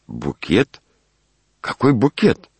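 high, startled question; excited, high-pitched query; agitated. A narrator's reading. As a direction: leap up in pitch with sudden agitation. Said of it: "взволновался Иван Александрович".

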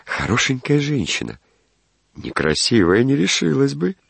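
high, lilting delighted speculation; tickled, self-pleased lilt; smug musing. A narrator's reading. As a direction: muse coyly, sliding up high. Smug daydream about the admirer.

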